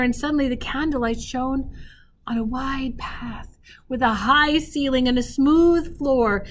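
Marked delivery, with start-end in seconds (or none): none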